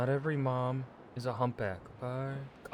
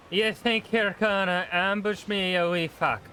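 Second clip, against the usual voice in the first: louder, higher, slower taunting shout; angry shout; 7 semitones higher, 7 dB louder, slower than usual. Real, not acted. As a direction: yell the threat at them. Escalated taunt at enemies.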